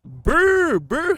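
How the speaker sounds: high, loud rolled brr like a gun; playful imitated gunfire; mock gunfire brr. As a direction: trill out gunshots, high and loud.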